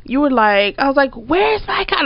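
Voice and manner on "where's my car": mad. Retold or imitated. Storyteller recalling a call about the missing car, mad. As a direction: retell an angry demand in a raised pitch. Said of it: "Like, I'm mad".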